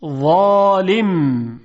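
ظالم with a thick, emphatic ظ; heavy za. Shown as a demonstration. Teacher demonstrates the heavy ظ in ظالم. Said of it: "با حرف ظا".

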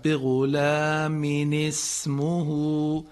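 slow, drawn-out chanted بغلام اسمه with every vowel held; careful Quran recitation. Shown as a demonstration. Teacher slowly models بغلام اسمه for radio listeners, chanted. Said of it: "به این تعابیر و این تأکید بکنیم".

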